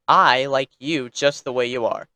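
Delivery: ordinary delivery, nothing marked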